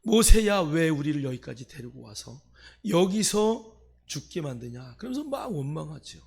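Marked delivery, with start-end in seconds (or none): 0.0-5.0 loud outburst on the name, then accusing; resentful complaint. Retold or imitated. Israelites blaming Moses for bringing them here, resentful complaint. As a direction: cry out in reproach, imitating the grumblers. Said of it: "원망하는 거예요".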